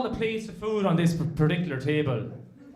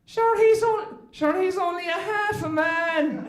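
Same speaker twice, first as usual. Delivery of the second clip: put-on much higher, slow dismissive old farmer; old Irish farmer; 12 semitones higher, 3 dB louder, slower than usual. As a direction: scoff at the son. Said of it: "and he says".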